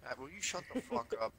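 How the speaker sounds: high-pitched exasperated demand; exasperated outburst. Real, not acted. Player telling Matt to shut up, exasperated high voice. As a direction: tell him off, pitch jumping high. Snapping at a chatting teammate.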